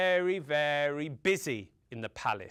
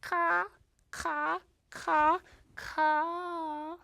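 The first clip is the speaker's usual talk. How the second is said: high-pitched, spaced-out caws; Mama Crow; 11 semitones higher, much slower than usual. A narrator's reading. Mother crow to her mate after his trick, high caws. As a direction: caw high, one call at a time. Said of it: "She looked at him and she said".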